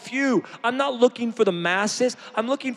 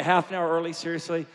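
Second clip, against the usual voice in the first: lower, stammering whine of an excuse; mock lazy friend; 5 semitones lower than usual. Preacher mimicking a friend who won't get up early, lower mock whine. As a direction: play the sleepy friend making excuses.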